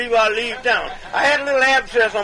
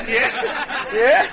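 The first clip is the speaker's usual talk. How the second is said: ordinary talk, nothing marked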